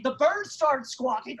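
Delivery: normal talking voice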